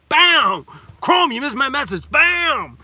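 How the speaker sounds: high, loud playful "Bam!" as if punching; hammed-up fake punch at a viewer who got missed; mock punch shouts. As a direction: shout it like landing a cartoon punch. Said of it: "you guys can punch me in the face later".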